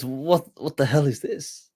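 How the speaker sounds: retold agitation, voice lifted; worked up. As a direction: act out a worked-up scholar's disbelief. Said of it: "he gets worked up".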